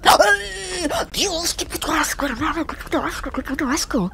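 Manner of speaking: high-pitched startled squeal of disgust; squealing, creeped-out freak-out; grossed-out panic. Real, not acted. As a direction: shriek out in revulsion, words tumbling. Startled and disgusted by apparent bug.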